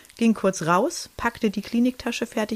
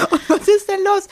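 high, louder alarmed question; husband's alarmed reply; 12 semitones higher, 6 dB louder than usual. Retold or imitated. Husband answering his wife's cry, mock alarmed shout. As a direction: call back in a startled rush.

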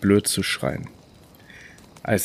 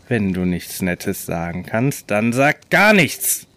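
angry; slower than usual. Acted. Clown snaps at his victim.

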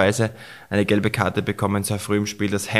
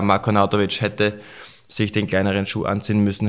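ordinary talk

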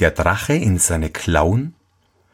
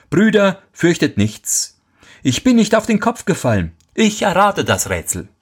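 put-on cocky young soldier; 11 semitones higher than usual. Carefree third soldier speaks.

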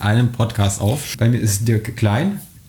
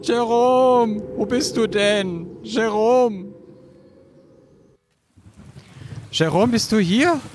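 calling out; 8 semitones higher, slower than usual. Acted. Searching, calling out for Jerome.

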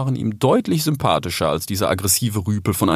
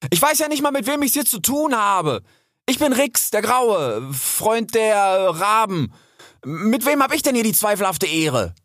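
high, imperious introduction; indignant, lofty challenge, pitched high; commanding tone; 12 semitones higher than usual. A narrator's reading. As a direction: announce yourself boldly to intimidate a bigger rival. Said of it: "irgendetwas in dem Ton, den Rix".

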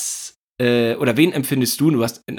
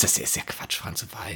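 whispered aside; 6 semitones lower, 12 dB quieter than usual. Real, not acted. Quick aside to co-host.

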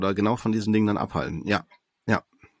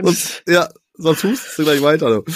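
mock scolding; 5 semitones higher, 4 dB louder than usual. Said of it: "war nur ein Scherz".

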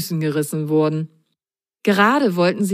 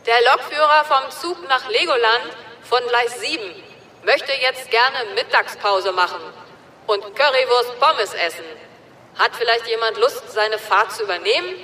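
higher loudspeaker announcer; station announcement; 5 semitones higher than usual. A narrator's reading. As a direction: read it like a platform announcer. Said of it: "eine Lautsprecherdurchsage".

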